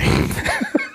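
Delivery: squeaky high-pitched laugh. Real, not acted. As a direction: burst into a shrill giggle. Laughs at own joke.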